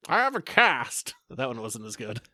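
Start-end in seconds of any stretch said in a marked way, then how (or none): 0.1-1.1 slow, high Snidely Whiplash villain; cartoon villain voice. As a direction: twirl the mustache, slow and high. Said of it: "you're sounding like, kind of like a wannabe".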